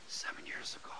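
whispered in disbelief; stunned whisper. Retold or imitated. Preacher recalling his shock at the years passed, whispered. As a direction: breathe it out, amazed.

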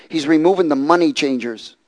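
normal talking voice

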